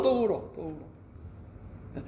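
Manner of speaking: slow, breathy near-whisper; drawn-out whispered echo; hushed repetition. Acted. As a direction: let it fade almost to a whisper, slowly. Repeating that Joseph weeps again.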